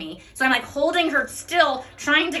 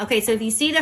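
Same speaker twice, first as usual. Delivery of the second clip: normal talking voice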